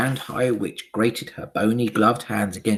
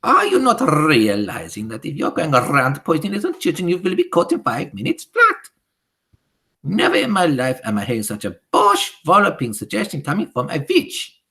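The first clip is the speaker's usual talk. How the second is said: furious witch; 8 semitones higher, 9 dB louder than usual. Acted. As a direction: berate the fool with scorn. Grand High Witch scolds.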